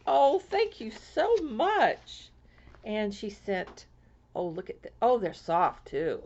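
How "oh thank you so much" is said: gushing thanks. Real, not acted. Thanking sender of squares.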